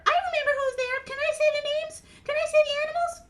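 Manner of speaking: eager, loud high puppet voice; Mimi. Acted. Mimi asks to name the animals, eager high puppet voice. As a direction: plead for a turn as a tiny character.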